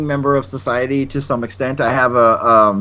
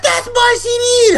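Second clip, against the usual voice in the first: very high, drawn-out mock shriek; imitated angry yell; 4 dB louder, slower than usual. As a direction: yell it like an outraged girl. Retold sister yelling through the door.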